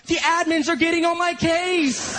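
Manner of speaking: high, slow, exasperated whine of protest; acted angry griping; angry complaint. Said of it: "angry I Ms from Harris".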